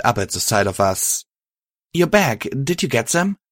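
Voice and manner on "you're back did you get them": high, eager questioning; bright-eyed excitement without extra volume; excited. A narrator's reading. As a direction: ask it excitedly, pitch well up. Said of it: "she asked excitedly".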